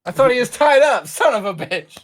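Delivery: high, loud cry of shock; real disbelief, voice jumping up loud; shocked outburst. Real, not acted. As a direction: blurt it out in high alarm. PC freed, player reacts in shock.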